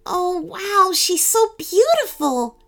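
put-on high, awed little girl; high-pitched amazed girl's voice; young girl. A narrator's reading. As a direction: gaze up in wonder as a child. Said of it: "said Rachel".

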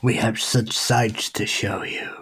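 slow, whispered, sinister; Pinhead whisper. Retold or imitated. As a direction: breathe it slow and menacing. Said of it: "easing yourself into Hellraiser".

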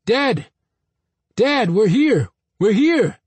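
loud, high calling after the car; retold screaming. Boy calling after his father's departing car, shouting. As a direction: yell to a father driving off. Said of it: "I was screaming".

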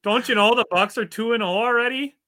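high, loud exaggerated Canadian lilt; mock Canadian accent. Cohost joking about Wisconsin accents, hammed-up Canadian accent. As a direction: play the cartoon Canadian fan, all sing-song. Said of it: "are you guys Canadian".

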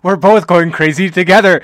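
loud, high laughing remark. Real, not acted. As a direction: laugh through the words. Laughing at the story.

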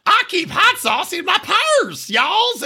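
mock Southern accent. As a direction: put on a fake Southern accent like a politician pandering. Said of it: "talking like a, you know, South".

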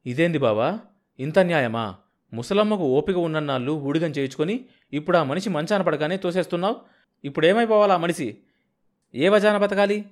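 scornful challenge to the brother-in-law; contemptuous. Acted. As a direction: sneer at him in disgust. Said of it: "ఈసడింపుగా మాట్లాడాడు".

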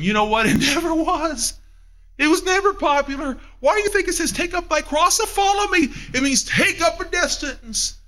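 climbing high and urgent; fervent high-pitched preaching; rising preacher fervor. Real, not acted. Preacher rising to full force.

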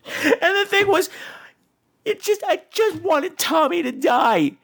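high, slow, tearful-sounding whine; mock-sobbing exasperation; whining despair. Real, not acted. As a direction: drag it out like you might cry. Exasperated whine about the film.

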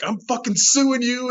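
high, slow angry threat; mock the hysterical mother screaming it; upset mother threatening to sue, high imitated fury; imitating furious mom.

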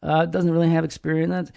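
high, soft, hesitant voice; very gentle. Retold or imitated. Imitating Russ Moore on Trump, very gentle and tentative. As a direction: tiptoe through the criticism, high and soft with halting pauses. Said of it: "very gently say things like".

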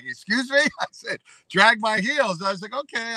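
high, disbelieving replies; incredulous retort. Retold or imitated. Retelling his reply to the pro, mock disbelief. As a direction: act out the stunned comeback.

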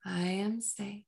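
soft, slow hypnotic induction; slow hypnotist voice. Acted. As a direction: lull them down gently and slowly. Hypnotic affirmation.

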